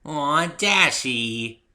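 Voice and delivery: drawn-out, high and touched; pleased. Acted. Pinkie, touched by Dash, coos happily. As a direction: melt at the compliment.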